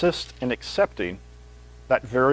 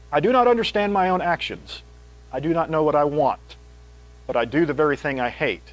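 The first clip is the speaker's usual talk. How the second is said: raised, louder reading of Paul; scripture reading; 4 semitones higher, 4 dB louder than usual. Acted. Preacher reads Romans to the congregation, fervent. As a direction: read the passage out with fervour.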